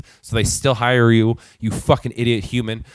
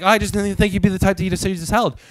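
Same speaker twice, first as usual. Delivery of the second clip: fast, higher-pitched mock cashier voice; mocking imitation; 5 semitones higher, faster than usual. Customer retelling the cashier's remark about his salad, mockingly.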